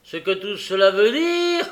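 angry incredulous repeat; furious echo. A narrator's reading. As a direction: throw the question back in fury, ending high.

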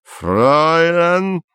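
menacing. A narrator's reading. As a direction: jut the jaw and warn her. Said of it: "угрожающе выпячивая челюсть".

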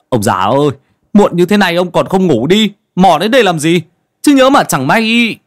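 hurried warning. Acted. Villager hurriedly blocks the old man from going in. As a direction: rush to stop the old man. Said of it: "vội vàng ngăn cản".

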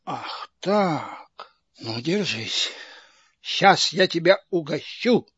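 soft and dangerous threat; menacing low threat. Acted. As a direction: drop to a low, quiet menace. Menacing threat before whipping.

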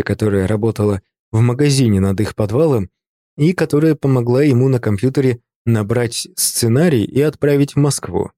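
usual speaking voice

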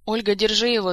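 loud urgent cry for help; shouting. A narrator's reading. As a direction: call out sharply for someone to act. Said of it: "закричала Азиль".

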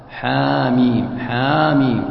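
حاميم drawn out slowly, long held ii; chant-like stretch on both syllables of حاميم; long madd. Shown as a demonstration. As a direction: hold the ميم of حاميم for six beats. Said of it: "تمد ست حركات".

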